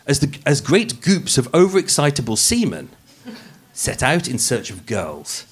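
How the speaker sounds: dramatic true crime narration; podcast narration. Acted. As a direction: read it like a crime podcast host. Narration resumes.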